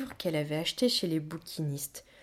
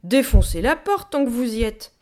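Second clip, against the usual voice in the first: loud, higher sarcastic shout; cri exaspéré; 5 semitones higher, 10 dB louder than usual. A narrator's reading. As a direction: yell at whoever is pounding on the door. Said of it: "cria-t-elle". (crier fort, exaspérée)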